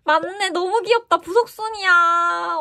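high and loud, stretching 부석순이야 slowly; excited. Real, not acted. Fan confirming her favourite subunit, gushing. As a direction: gush with delight.

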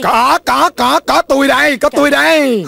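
high, rapid-fire hollering; urgent, repeated call; eager answering call. Acted. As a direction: announce yourself to the rescue. Man bursts out answering the call.

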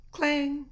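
high, ringing metal clang; voiced machine clang; imitated clang. Shown as a demonstration. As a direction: ring the word out loud and high. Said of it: "Machine noises".

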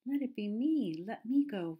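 high, loud, eager plea; lightly voiced fawn bursting to be picked; eager volunteer. A narrator's reading. Fawn begging to be the one to go, eager. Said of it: "volunteered the fawn".

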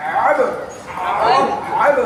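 আব pressed from the throat, loud; teacher drills the correct ain. Shown as a demonstration. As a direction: tighten the throat on আব. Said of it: "কণ্ঠনালীকে চাপ দিয়ে উচ্চারণ করতে হবে".

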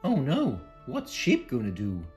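ordinary delivery

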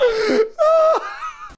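high, wailing laughter; shrieking laugh. Real, not acted. Friend laughs at the scared player, shrieking laugh. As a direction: laugh until you're nearly crying.

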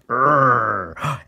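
loud drawn-out mock growl; angry cartoon growl. Cartoonist drawing an open angry mouth, mock growl. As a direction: growl for an angry stick man.

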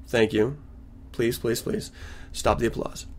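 low, soft thanks to an imaginary crowd; mock modesty. Host to imagined applauding audience, mock-modest murmur. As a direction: humbly wave off applause, tongue in cheek.

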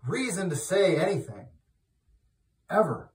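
loud, high, fired-up sermon voice; preaching at full force. Acted. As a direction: build it up and hammer it home to the flock.

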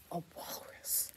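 breathy secret whisper. Pretend. Whispered aside to children.

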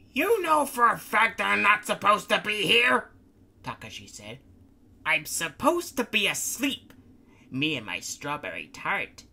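whiny Takashi. Acted. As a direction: sulk about being woken. Takashi complains, different voice.

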